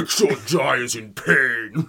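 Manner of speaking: loud, slow, hammed-up devil voice through a laugh; Satan voice. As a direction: boom it out like a cartoon Satan, laughing. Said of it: "the Satan voice from South Park".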